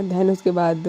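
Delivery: plain reading voice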